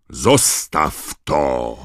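loud, drawn-out command; Moody's shout. A narrator's reading. Moody orders someone off the ferret, shouting. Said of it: "krzyknął Moody".

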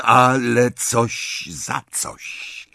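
slow, firm goblin voice. Acted. Goblin firmly interrupts Harry's thanks, slow and firm. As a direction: cut him off, firmly and slowly. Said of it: "dokończył stanowczym tonem goblin".

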